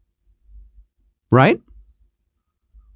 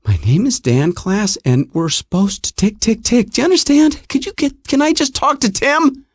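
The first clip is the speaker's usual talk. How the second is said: high exasperated urgency; 5 semitones higher than usual. Retold or imitated. Pleading at the front desk.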